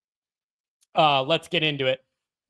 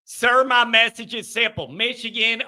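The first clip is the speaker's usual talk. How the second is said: ordinary talk, nothing marked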